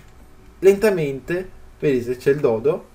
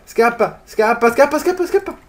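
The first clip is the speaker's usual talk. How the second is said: frantic urging; 7 semitones higher, 5 dB louder than usual. Real, not acted. Urging pet to flee.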